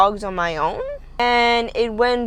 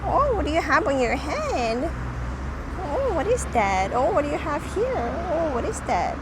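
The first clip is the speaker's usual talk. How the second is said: talking to a dog; 6 semitones higher than usual. Real, not acted. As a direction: coo curiously at a dog. Baby talk to a dog.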